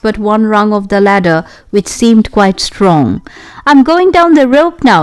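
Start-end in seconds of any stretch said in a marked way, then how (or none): 3.7-5.0 called up high down a well shaft; shouted. A narrator's reading. Dick shouting up the well to Anne, calling out. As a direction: pitch it up and call down the shaft. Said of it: "he shouted to Anne".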